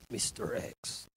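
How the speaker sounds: hushed, low whisper of intrigue; mysterious. Shown as a demonstration. Radio host teasing the mystery suspect, hushed and mysterious. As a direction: drop it to a sinister hush.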